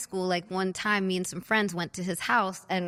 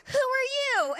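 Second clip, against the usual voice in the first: very high, shocked mock question; mom's startled demand; slower than usual. Mom finds a girl hiding, mock shock. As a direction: squeak it like a stunned mother.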